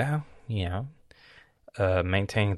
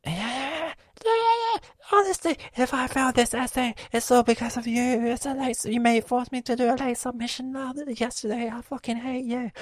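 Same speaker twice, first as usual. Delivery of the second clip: much higher whiny mimic, last line whispered; mocking sister. Imitating his sister's complaint at him, mocking whine. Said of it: "she starts talking with me".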